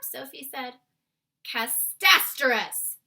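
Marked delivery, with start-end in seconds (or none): 1.4-3.0 loud, slow giant cry; giant's cry. A narrator's reading. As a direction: bellow it out like a giant. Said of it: "cried the B F G".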